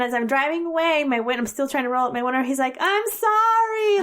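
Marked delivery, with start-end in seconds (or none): 2.8-4.0 drawn-out high mock yell; yelled apology. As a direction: imitate someone yelling sorry after a departing car. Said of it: "Like, he yelled it out".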